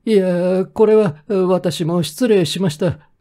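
high, slow apologetic mayor; mayor's voice. A narrator's reading. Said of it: "と市長が言った".

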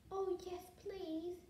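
plain reading voice

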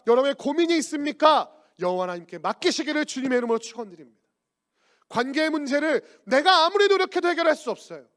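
loud, high-pitched exhortation; insistent pulpit cadence, voice lifted; full-force preaching. Real, not acted. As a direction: proclaim it to the whole congregation with rising fervor.

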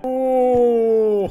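high, loud disgusted "ooh". Real, not acted. Streamer reacting to gross dessert with a long ooh. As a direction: recoil with a drawn ooh.

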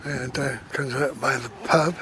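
ordinary delivery

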